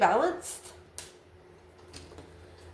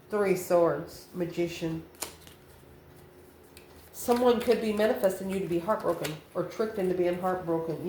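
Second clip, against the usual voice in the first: ordinary delivery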